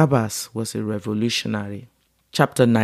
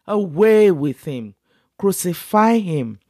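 higher, slow forceful yell; 6 semitones higher than usual. A narrator's reading. Crowd yelling for crucifixion, slow higher yell. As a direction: drag out the demand as a cry. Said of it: "they yelled".

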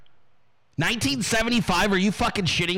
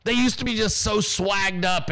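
normal talking voice

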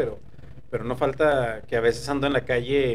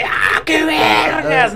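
put-on high, slow, loud drunk yell; drunk guy shout; 12 semitones higher, 7 dB louder, much slower than usual. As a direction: holler it like a drunk. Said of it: "Y cabrones que".

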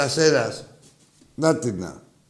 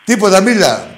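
sharp retort; 8 semitones higher, 10 dB louder than usual. Real, not acted. Host snaps back at caller.

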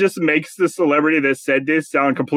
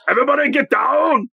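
put-on higher, shouted Austrian action-hero accent; Arnold Schwarzenegger accent; 8 semitones higher than usual. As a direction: bark it like a hammed-up Austrian action star. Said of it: "the only thing I can do is".

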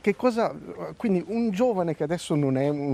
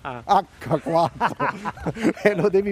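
laughing; 4 semitones higher, 3 dB louder, slower than usual. Real, not acted. Correcting mic name, laughing.

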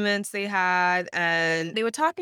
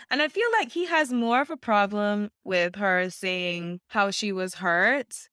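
ordinary talk

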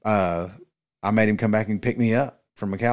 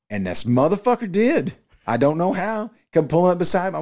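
high, loud amazement; amazed; 9 semitones higher, 4 dB louder than usual. Real, not acted. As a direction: marvel at it out loud. Amazed retelling friend found him.

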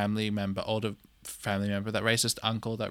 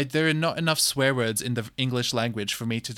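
usual speaking voice

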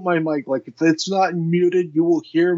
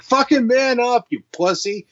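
mock scolding; 8 semitones higher than usual. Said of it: "I'm like".

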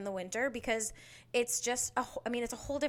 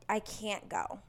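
normal talking voice

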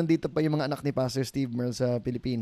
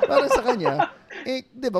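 talking through laughter, higher; real laugh, higher pitch; 11 semitones higher, 5 dB louder than usual. Real, not acted. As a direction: laugh while explaining. Laughing at teasing.

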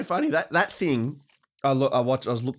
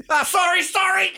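very high, loud hammed-up sorry; frantic mock apology; 4 dB louder than usual. Apologising to the buyer mid-lift, mock frantic. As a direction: squeal an exaggerated apology.

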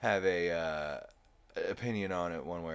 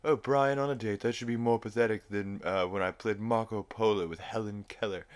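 Stewie impression; 4 semitones higher, 4 dB louder than usual. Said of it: "He's like".

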